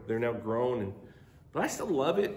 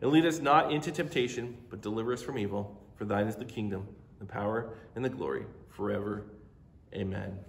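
a hushed congregational prayer; prayer recitation; 4 semitones lower than usual. Acted.

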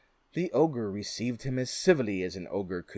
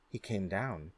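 ordinary delivery

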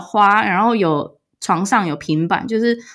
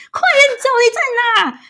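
much higher, frantic call; calling out urgently; slower than usual. Retold or imitated. Girlfriend searching the hotel for the boyfriend, frantic high call. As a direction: hunt for him room to room. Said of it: "我在那边大叫".

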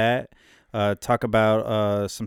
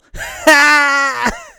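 high loud laughing shout; gloating laugh shout; 9 dB louder than usual. Real, not acted. Gloating over rival fan.

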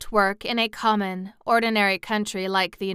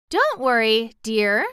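lifted, louder reassurance; exclaiming; 3 semitones higher, 6 dB louder than usual. A narrator's reading. Girl comforting the worried kitten, exclaiming. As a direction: exclaim it to comfort, pitch raised. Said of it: "Dorothy exclaimed".

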